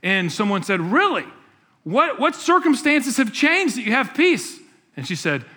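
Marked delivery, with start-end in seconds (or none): 0.8-4.6 surprised. Retold or imitated. Skeptical friend quoted.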